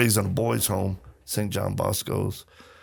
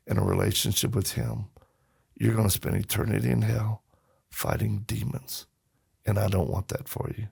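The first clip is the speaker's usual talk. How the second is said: soft, sober fatherly appeal; earnest warning; 5 dB quieter than usual. Retold or imitated. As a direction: retell a heartfelt warning, dropping softer. Dad earnestly warns tough stranger.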